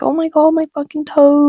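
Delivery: high, loud mock wail; retold screaming panic. Hurt kid crying out over a cut toe, mock screaming. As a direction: act out a kid's panicked outcry. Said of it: "like, screaming, crying".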